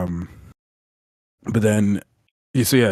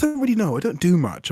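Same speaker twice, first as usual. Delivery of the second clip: mock airy consultant; 12 semitones higher, 10 dB louder than usual. Mimicking consultant's admission.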